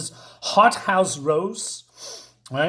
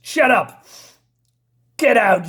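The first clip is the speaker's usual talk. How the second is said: higher, louder mock-angry dismissal; angry order; 4 semitones higher, 6 dB louder than usual. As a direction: throw them out with a raised voice. Carmine orders them out angrily.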